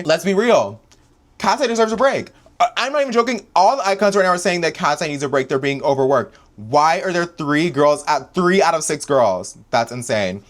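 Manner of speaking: high, loud rant at the label; worked-up yelling about the group. Real, not acted. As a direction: yell it out, fed up. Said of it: "I'm done yelling about this".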